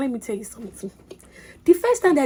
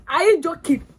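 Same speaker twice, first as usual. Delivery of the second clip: excited disbelief; 6 semitones higher, 4 dB louder than usual. Real, not acted. Disbelief at passing, shouted.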